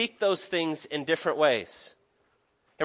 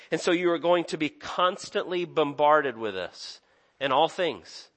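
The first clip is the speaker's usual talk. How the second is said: ordinary talk, nothing marked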